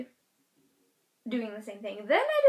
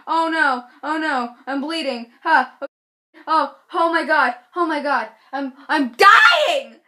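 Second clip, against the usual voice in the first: gasping, hammed-up alarm; freaking out; 3 semitones higher, 10 dB louder than usual. Anyone seeing blood on hands, escalating mock panic. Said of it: "So you freak out".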